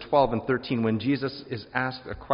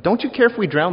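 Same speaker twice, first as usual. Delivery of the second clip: higher, louder frantic cry to Jesus; panicked plea; 10 semitones higher, 11 dB louder than usual. Retold or imitated. Disciples in the sinking boat begging Jesus, frantic and loud. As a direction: voice the disciples' alarm, loud and high.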